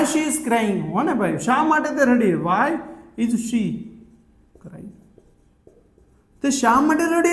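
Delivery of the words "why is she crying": long pauses between words; feed it to learners piece by piece; halting, spaced-out teaching delivery; word-by-word modelling. Shown as a demonstration. Teacher builds the question word by word.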